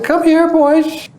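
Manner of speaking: very high, slow coaxing call; reassuring call. Retold or imitated. Baba reassures frightened boys, very high and slow. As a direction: draw them in gently.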